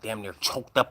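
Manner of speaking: ordinary talk, nothing marked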